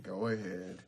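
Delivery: low, soft, dejected concession; defeated troll. Acted. Beaten DJ Troll lets the goats into his party, dejected. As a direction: give in quietly, all energy gone. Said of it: "slumped his head and his shoulders".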